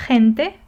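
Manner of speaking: G in "Gente," as a raspy throaty H, ending on a rise; G as flu H. Shown as a demonstration. Teacher showing learners a flu G, G in "Gente," as rough H. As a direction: start "Gente," with a rough, loud H sound. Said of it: "kinda like a flu H or a flu G".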